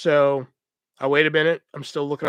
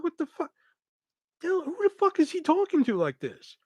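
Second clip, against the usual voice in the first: high, hushed disbelief; retold indignant bafflement, pitched way up; incredulous; 12 semitones higher than usual. His incredulous reaction to being nagged.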